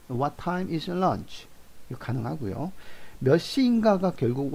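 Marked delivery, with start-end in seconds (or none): 0.1-1.5 "What time is lunch" drawn out slowly, ending on "lunch"; slow model sentence. Shown as a demonstration. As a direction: spell out the question slowly. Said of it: "몇 시가 점심이니?". (천천히 또박또박 읽는 질문)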